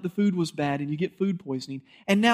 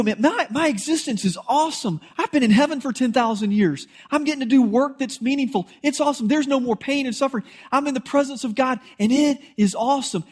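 higher, beaming voice of someone in heaven; joyful praise; 6 semitones higher than usual. Pretend. Believer in heaven telling a questioner, joyfully excited. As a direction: overflow with joy about heaven.

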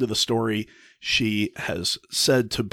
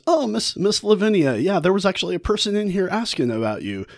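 druggist voice; 8 semitones higher than usual. Retold or imitated. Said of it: "the drugstore says".

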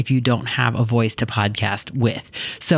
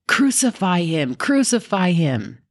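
higher, slow mock crowd cry; shouting; 6 semitones higher, slower than usual. A narrator's reading. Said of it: "began shouting".

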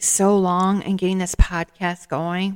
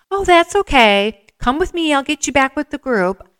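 pitched up and kind, like scooping up a straggler; friendly voice; 8 semitones higher, 4 dB louder than usual. Retold or imitated. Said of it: "this friendly voice".

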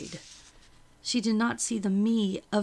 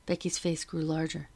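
usual speaking voice

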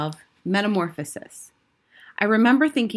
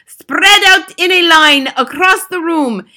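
loud, high-pitched witch marshalling her troops; screamed commands; 9 semitones higher, 10 dB louder than usual. A narrator's reading. Grand High Witch orders witches to corner the boy, screamed commands. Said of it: "screamed the Grand High Witch".

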